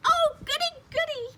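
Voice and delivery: very high, loud squeal of delight; thrilled squeal. A narrator's reading. May thrilled by the drawing assignment, squealing. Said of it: "May squealed, very thrilled".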